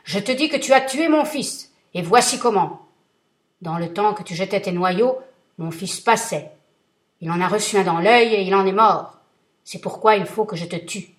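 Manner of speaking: thundering vengeful genie; terrible genie voice. Acted. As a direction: roar it down at him like a giant. Genie pronounces sentence.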